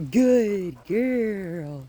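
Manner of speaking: drawn-out animated praise. Shown as a demonstration. Trainer encourages the dog to come, animated praise. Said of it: "get a little bit more animated".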